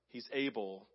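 normal talking voice

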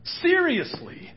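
high, loud and slow, drawn out; exasperated seriously. Real, not acted. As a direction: stretch it out in disbelief.

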